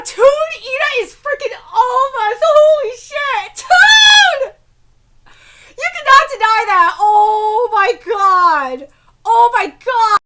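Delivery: loud, high exclamations; stunned excitement. Real, not acted. Fan insisting Ida is all of us, stunned excitement. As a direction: cry out in overwhelmed disbelief.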